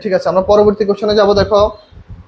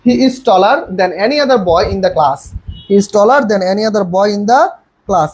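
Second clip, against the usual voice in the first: slow dictation; much slower than usual. Shown as a demonstration. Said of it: "right answer কি বলো তো".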